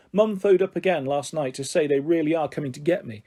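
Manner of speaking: plain reading voice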